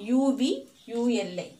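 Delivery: letters of uvula one by one; spelling it out. Shown as a demonstration. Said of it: "ഇംഗ്ലീഷിൽ ഉവൂള എന്ന് പറയുന്നത്".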